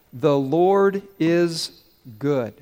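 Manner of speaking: very slow, each word set apart; slow model phrase. Shown as a demonstration. Pastor giving the congregation four words to shout, slow and measured. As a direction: spell out the phrase word by word, unhurried. Gives crowd the phrase to repeat.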